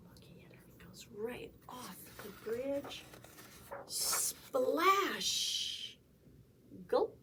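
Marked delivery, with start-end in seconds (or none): none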